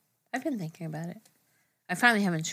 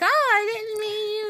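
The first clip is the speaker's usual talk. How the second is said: mock innocent. Commenter backpedalling, mocked.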